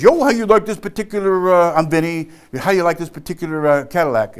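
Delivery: chummy mock sales patter; salesman's pitch. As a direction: open high and brisk, working the customer. Imitates salesman's pitch to new customers.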